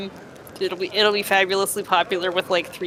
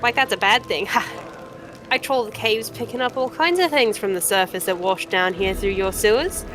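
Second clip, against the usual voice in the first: higher-pitched, scornful scavenger-merchant; feisty trader putting the stranger in their place; brash cave trader; 4 semitones higher than usual. Acted. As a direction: haggle like a proud junk dealer.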